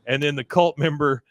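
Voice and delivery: normal talking voice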